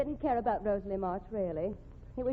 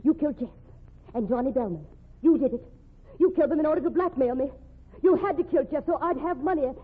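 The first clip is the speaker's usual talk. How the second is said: higher, louder accusing shout; furious accusation; 5 semitones higher, 6 dB louder than usual. Acted. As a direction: hurl the accusations at him. Woman accusing the killer.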